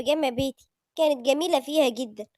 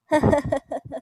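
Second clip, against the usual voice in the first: chuckle. Acted. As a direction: chuckle at a friend's outburst. Friend reacts to proposal. (ضحكة قصيرة)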